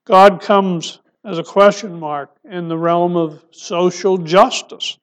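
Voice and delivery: ordinary talk, nothing marked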